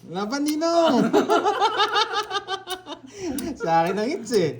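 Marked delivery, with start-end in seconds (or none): 0.0-1.0 high-pitched playful rallying call; egging on. Real, not acted. Player goads ninong to fight, egging on. 1.0-2.9 long, high cackling laugh; high-pitched laugh. Real, not acted. Player laughs after challenging ninong, high-pitched laugh.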